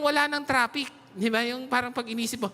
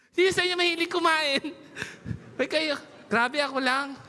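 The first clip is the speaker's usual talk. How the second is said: normal talking voice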